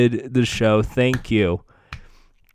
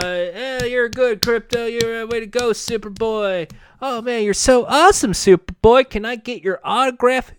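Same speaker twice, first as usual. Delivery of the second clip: higher-pitched mock fan voice; fawning cops; 7 semitones higher than usual. Cops to Superboy, fawning praise. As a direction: gush like starstruck fans.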